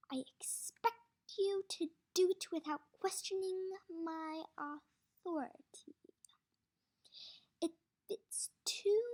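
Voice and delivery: stern scolding. Acted. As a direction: lay down the law. Warden scolding Podensky.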